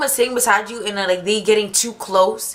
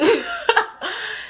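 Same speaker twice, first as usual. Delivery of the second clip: high laugh; 13 semitones higher than usual. Real, not acted. Laughs at own story.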